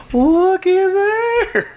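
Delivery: high, slow, stretched-out wonder; drawn-out amazement. Real, not acted. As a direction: marvel at it, dragging the words. Marvelling at cooked rings.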